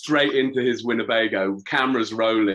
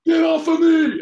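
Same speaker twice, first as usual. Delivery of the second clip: sudden high shout; a full yell in a retold scuffle; 7 dB louder than usual. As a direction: yell it to push someone off.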